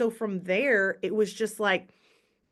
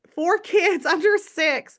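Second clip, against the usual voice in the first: laughing it out, pitched up and louder; a burst of laughter through the words, higher and louder; 9 semitones higher, 7 dB louder, slower than usual. Real, not acted. Laughing over having many kids.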